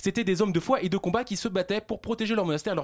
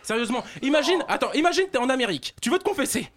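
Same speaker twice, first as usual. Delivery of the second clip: ordinary talk, nothing marked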